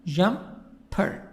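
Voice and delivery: "Jumper" broken into two separate parts, jum-per; split into syllables. Shown as a demonstration. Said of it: "The stress is in the first part".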